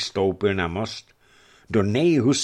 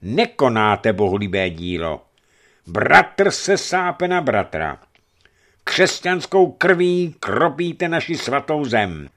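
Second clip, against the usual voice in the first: forceful address; 5 semitones higher, 6 dB louder than usual. A narrator's reading. Archimandrite commands the fighting crowd to stop, forceful address. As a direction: thunder the bishop's order to the crowd. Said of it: "důrazně oslovil zástup".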